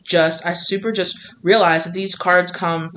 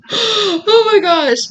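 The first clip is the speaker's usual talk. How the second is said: tearful; 13 semitones higher, 5 dB louder, much slower than usual. Real, not acted. Reader overcome with emotion.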